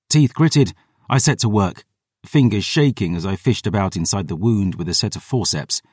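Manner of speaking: plain reading voice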